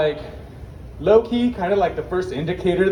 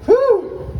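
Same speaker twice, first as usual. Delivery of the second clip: high breathy whew after holding the mask; breathless whew; 4 dB louder than usual. Real, not acted. Exhale in hot costume.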